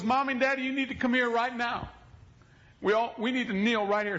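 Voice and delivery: gather them like a small boss; bossy child. Retold or imitated. Young son summoning parents to kneel, high and firm.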